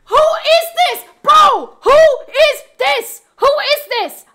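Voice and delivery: high, loud cry of disbelief; high stunned yelling; disbelieving shout. Real, not acted. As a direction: yell it out, floored by the surprise. Stunned by a mystery gifter.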